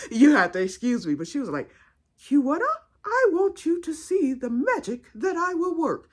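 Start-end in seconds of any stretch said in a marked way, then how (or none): 2.3-6.1 high, grandly enunciated aunt impression; Aunt Ada. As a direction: imitate a finishing-school aunt making a grand announcement. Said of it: "That's just how she talks".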